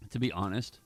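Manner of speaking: ordinary talk, nothing marked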